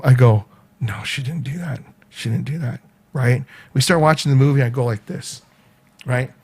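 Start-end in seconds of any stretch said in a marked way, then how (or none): 0.8-2.8 hushed, low talking to himself; retold private denial. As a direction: quietly try to convince yourself.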